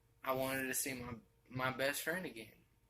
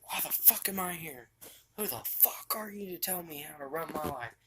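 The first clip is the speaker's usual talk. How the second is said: snapping; 5 semitones higher than usual. Retold or imitated. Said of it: "I snapped at her".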